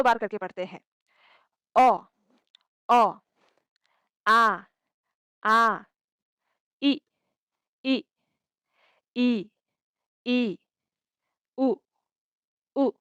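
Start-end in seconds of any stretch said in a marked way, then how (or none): 1.8-12.9 each vowel called out loud, twice over; teacher calling out vowels loudly for repetition; loud clear drill. Shown as a demonstration. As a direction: belt out each sound for the class to copy. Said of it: "इस बार अच्छे से सुन लीजिए".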